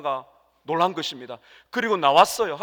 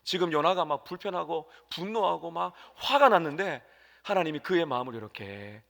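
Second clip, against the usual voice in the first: ordinary talk, nothing marked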